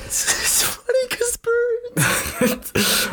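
high, slow laugh-crying words. Real, not acted. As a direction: squeal it out through laughter. Mocking partner, laughing cry.

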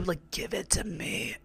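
breathy whispered urging. Retold or imitated. Retelling Jade egging Juju on, whispered. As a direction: whisper it like a coach leaning in.

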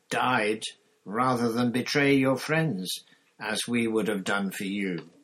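loud, impassioned condemnation; roar. A narrator's reading. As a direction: hammer out the reproach at full anger.

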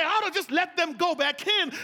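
loud, high, God half-ready to give up; voicing God, preached. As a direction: ring it out as a fed-up God. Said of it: "God wanna say".

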